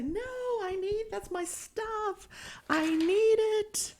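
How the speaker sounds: put-on high, slow pleading old woman; cling to your stuff, whining high; whiny old lady. Old hoarder begging cleaners to keep her stuff, high whine.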